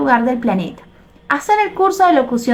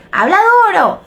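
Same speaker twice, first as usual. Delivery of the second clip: imitating a teacher barking, higher and a bit louder; mock teacher order; 7 semitones higher, 3 dB louder than usual. Teacher demanding a shy student speak up, mock stern bark. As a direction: snap it like an impatient older teacher. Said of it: "hay profesores ... que dice".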